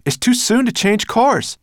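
high, urgent shout; calling out. A narrator's reading. Ben arguing with Sorrel over course, calling out. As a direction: warn loudly against it. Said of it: "he called".

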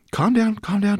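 usual speaking voice

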